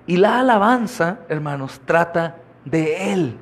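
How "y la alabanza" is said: ordinary talk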